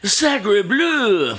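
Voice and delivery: high, mock French accent exclamation; french chef. Mock French exclamation answering partner.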